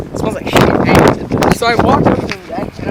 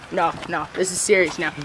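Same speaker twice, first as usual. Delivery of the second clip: low, softer, serious tone; retold stern warning; 6 semitones lower, 10 dB quieter than usual. Teen in timeout warns cousin, low serious voice. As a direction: drop it down and say it soberly.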